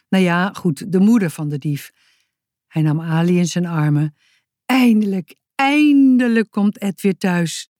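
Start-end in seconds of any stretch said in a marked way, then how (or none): 4.7-7.7 relieved and delighted; joyful relief. Acted. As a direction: sigh it out happily, stretching finally. Relieved exclamation, friend coming home.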